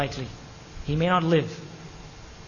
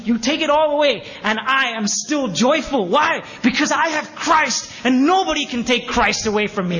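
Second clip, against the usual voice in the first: raised, ringing voice, high and loud; impassioned declaration; 7 semitones higher, 5 dB louder than usual. Acted. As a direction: proclaim it joyfully as Paul, at full force. Voicing Paul's joyful declaration.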